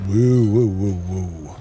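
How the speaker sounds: usual speaking voice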